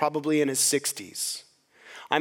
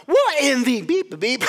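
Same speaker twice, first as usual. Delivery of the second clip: mock outraged outburst; 8 semitones higher, 6 dB louder than usual. Imitating mother-in-law turning on the usher, mock outrage. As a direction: imitate an indignant curse, bleeped out.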